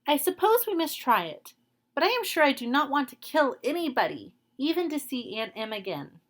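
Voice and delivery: put-on high girlish voice, hurried and unwilling; reluctant little girl. Girl Dorothy agrees to go but dreads killing, high.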